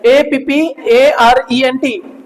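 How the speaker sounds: each letter said separately, with small gaps; spelling out. Shown as a demonstration. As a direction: spell apparent slowly, letter by letter. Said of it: "apparent बोलो".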